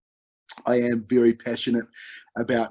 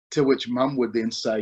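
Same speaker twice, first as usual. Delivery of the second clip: usual speaking voice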